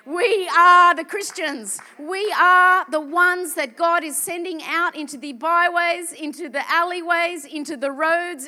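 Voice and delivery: loud, slow, building sermon cadence; rally the church to go out; preaching at full force. Acted. Preacher sends congregation out, loud slow rallying cadence.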